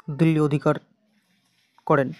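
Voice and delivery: ordinary talk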